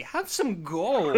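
slow, drawn-out mock offer through a laugh. Podcast host mocks the movie's bribe with drawn-out offer. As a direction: dangle the gold like a bribe, laughing. Mocking the movie's Nazi father.

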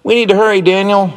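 put-on a boy shouting to his brother to rush; urgent shout. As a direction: hurry him along, pitched high. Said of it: "shouted Jonathan".

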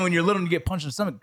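ordinary talk

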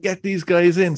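high-pitched mock welcome; changed, excited tone. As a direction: imitate a cop eagerly waving them in. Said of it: "totally changed their tone".

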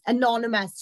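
ordinary talk

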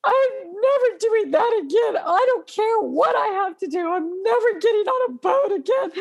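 high, tearful vow; crying protest. Retold or imitated. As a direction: sob out a vow never to go back. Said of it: "I was crying".

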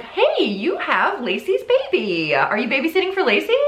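high-pitched imitated small talk, stretched then quick; mock friendly acquaintance. Church friend asking mother about the baby, mock friendly. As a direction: coo over the baby as a chatty acquaintance.

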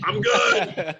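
mock holler of reassurance; calling back. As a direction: call it back to a friend rows away. Retold answer called back across plane.

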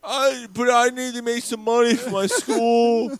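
high, drawn-out mock child voice; little fat kid. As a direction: plead slowly like a hammed-up little boy. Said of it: "and the little fat kid".

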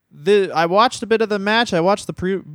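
ordinary delivery

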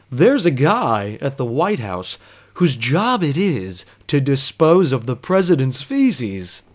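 slow, sleepy know-it-all drone; slow drugged drone. Retold or imitated. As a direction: drag it out like Jerry Seinfeld on propofol. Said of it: "Don't do that voice. He doesn't talk like that".

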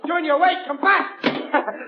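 high-pitched, urgent call; calling after someone. Acted. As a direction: call him back as he runs off. Father calls after fleeing son.

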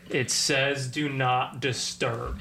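slow, low, groggy grumble; disgruntled daze. Retold or imitated. Guy retelling what he groaned at housekeeping, slow low grumble. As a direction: drag it out like a half-asleep grump. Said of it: "in a disgruntled daze".

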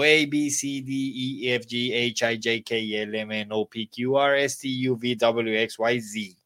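quick-fire recital with hardly a gap; super fast alphabet. Shown as a demonstration. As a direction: rattle the letters off in a run. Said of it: "Vamos a decirlo entonces súper rápido".

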